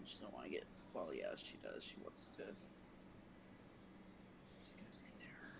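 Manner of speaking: low, hushed thinking aloud; muttering to oneself. Real, not acted. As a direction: drop to a near-whisper while deciding. Player thinks aloud about character's move.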